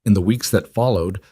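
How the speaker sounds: usual speaking voice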